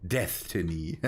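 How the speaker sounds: "Destiny" with the s lisped as th. Shown as a demonstration. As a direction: lisp the s in "Destiny" like dethtiny. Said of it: "you have to speak that with a heavy lisp".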